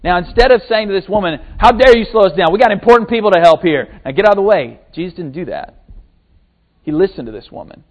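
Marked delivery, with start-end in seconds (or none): 1.6-4.8 mock impatience. Imagined impatient dismissal.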